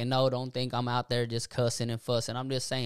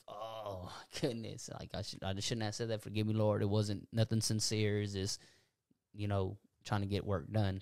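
hushed, much softer, self-reproach after an argument; quiet inner confession; 4 semitones lower, 7 dB quieter than usual. Retold or imitated. A man convicted after snapping at coworkers, praying in a soft hush. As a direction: murmur it to yourself, as if asking the Lord to forgive you. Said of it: "man, you're like".

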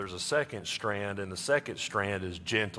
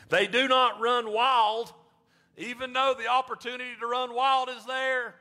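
preaching at full force; 11 semitones higher, 3 dB louder than usual. Acted. Pastor preaching to congregation, high and loud. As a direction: lift it up to the congregation like a sermon peak.

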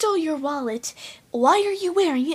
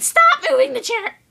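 fast, higher, shouting; high shrill order; 11 semitones higher, 6 dB louder, faster than usual. Acted. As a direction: snap it out quickly and loud.

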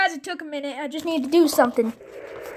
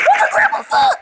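much higher mock outrage; silly shocked squeal; 4 dB louder than usual. Real, not acted. Gamer shocked by the game, squealing silly disbelief.

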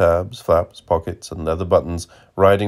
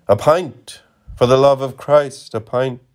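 high, louder desperate begging for a pint; dying man's plea; 7 semitones higher, 4 dB louder than usual. Retold or imitated. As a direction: beg for the pint like a dying man. Dying man's last pleading words.

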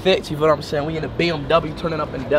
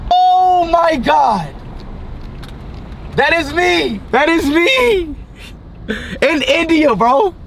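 excited yelling; 9 semitones higher, slower than usual. Real, not acted. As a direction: shout it in disbelief. Sees himself on billboard.

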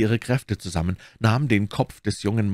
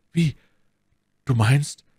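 choked by sobbing; 6 semitones higher, 4 dB louder than usual. A narrator's reading. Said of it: "von einem heftigen Schluchzen erstickt".

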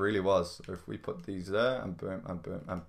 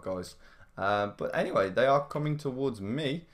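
normal talking voice

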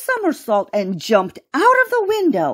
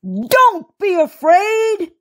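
high, slow villain's bellow; drawn-out, raised monster voice; bellowing alligator; 10 semitones higher, 4 dB louder, slower than usual. A narrator's reading. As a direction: bellow it out as a hungry gator. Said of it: "bellowed the alligator".

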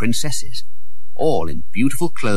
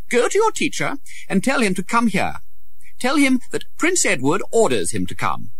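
put-on high young voice giving orders; commanding boy prince; 11 semitones higher than usual. A narrator's reading. Prince ordering a schoolboy, high and commanding. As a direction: expect to be obeyed.

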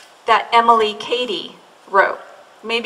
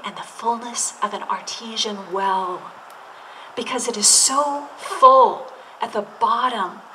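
hushed preacher fade; 5 dB quieter, slower than usual. Acted. Pastor hushing the congregation, then a slow whisper. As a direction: drop to a near whisper, slowing.

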